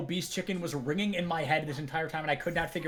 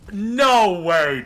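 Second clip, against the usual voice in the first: excited; 8 dB louder than usual. Real, not acted. Caster stunned by play.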